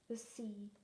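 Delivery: ordinary delivery, nothing marked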